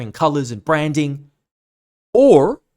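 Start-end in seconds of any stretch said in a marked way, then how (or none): 2.1-2.6 sudden loud or. Real, not acted.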